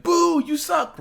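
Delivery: high, loud mock booing; jeering crowd. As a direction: heckle like a hostile crowd. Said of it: "she got so much hate".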